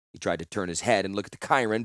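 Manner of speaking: plain reading voice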